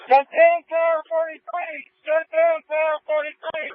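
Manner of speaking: high, slow urgent radio call; urgent firefighter radio. Real, not acted. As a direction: call it out over the radio, pressing.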